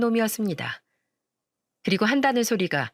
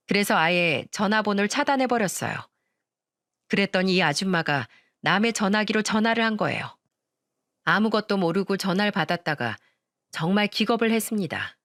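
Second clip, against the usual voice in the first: plain reading voice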